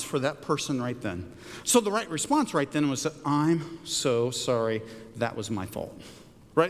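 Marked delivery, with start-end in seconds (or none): 3.3-5.9 slow, low, sincere apology; profusely apologizing. Retold or imitated. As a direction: own the fault, slower and lower. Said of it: "profusely apologizing".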